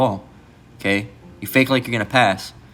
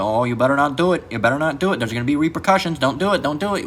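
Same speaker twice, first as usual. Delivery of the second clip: higher-pitched mock warning, climbing as it repeats; mock empty warning; 4 semitones higher than usual. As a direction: imitate a toothless threat, pitch rising. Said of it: "Biden, he's been pump faking".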